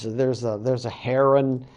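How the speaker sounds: ordinary delivery, nothing marked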